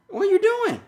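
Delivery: very high sing-song question. Real, not acted. Talking to toddler son on call.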